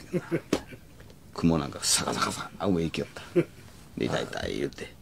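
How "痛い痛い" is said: mock whimper. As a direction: groan it softly like grandma. Grandma in pain, imitated.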